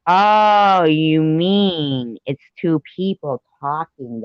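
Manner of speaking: high, loud and slow "oh, you mean"; light bulb goes on, drawn out; sudden realisation. Real, not acted. Sudden realisation reading chat.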